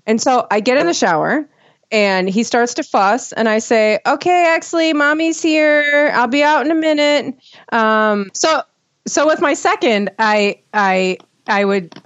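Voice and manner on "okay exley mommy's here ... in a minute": imitating a mom's higher-pitched comforting voice; reassuring mommy voice. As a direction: call soothingly to a fussing baby from the shower. Mother soothing baby from the shower.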